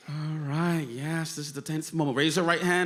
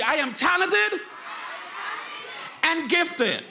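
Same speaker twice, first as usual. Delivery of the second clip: even more energy; 11 semitones higher, slower than usual. Shown as a demonstration. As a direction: shout it out, rally the whole room. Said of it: "You gotta say it with more energy".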